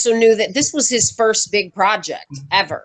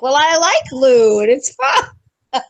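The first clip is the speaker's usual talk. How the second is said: put-on higher, innocent little-kid voice, stretching the end; imitated child; 6 semitones higher, 4 dB louder than usual. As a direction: lightly mimic a kid happily explaining. Imitates child protesting to director.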